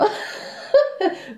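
petit rire aigu et soufflé. Real, not acted. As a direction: rire sans voix, dans un souffle. Rit de sa propre voix. (rire soufflé et étouffé)

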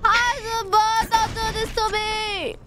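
loud high pleading wail; scared horror game streamer. Real, not acted. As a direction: beg the villain, voice up high. Pleading to boss.

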